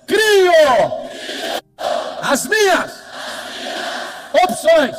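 high, loud shouted affirmation. Shown as a demonstration. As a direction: shout each word to fire up the crowd. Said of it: "Fala assim". (afirmação gritada, alta e aguda)

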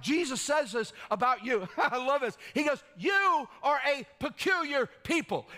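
high, loud, driving preacher voice; full-force preaching. Acted. Pastor rallying his congregation, high loud preaching. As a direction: lift the room, preach it hard.

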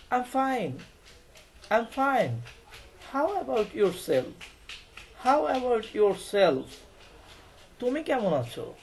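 playing Sara. Teacher voicing Sara answering the greeting, lightly. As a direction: act out Sara's friendly reply. Acting Sara's reply.

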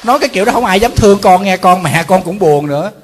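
loud, exasperated scolding of a careless son; a monk loudly scolding an irresponsible son in jest; loud mock scolding. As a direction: raise the voice and tell the boy off, half joking. Scolding the sons, half joking.

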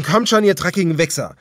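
defiant battle cry; shouting. A narrator's reading. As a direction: hurl the insult down the hill. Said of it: "schrie er den Hang hinunter".

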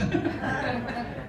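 short, high burst of laughter; amused laugh; real laugh. Real, not acted. Laughing at his own answer.

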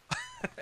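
a quick burst of real laughter; short laugh. Real, not acted. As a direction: let a laugh slip out. Laughs at how hard the mod is.

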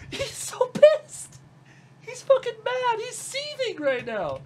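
very high squeaky mocking voice; gleeful taunting. Real, not acted. She gloats over Jeremy's fury, squeaky and high. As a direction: squeal with delight at his anger.

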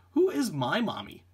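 ordinary talk, nothing marked

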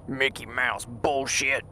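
ordinary talk

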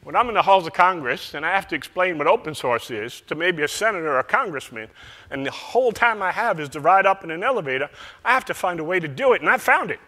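hint of a North Carolina accent; Southern accent. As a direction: mimic a Southern colleague's accent, loosely. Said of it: "he was talking in a Southern accent".

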